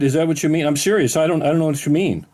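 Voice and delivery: normal talking voice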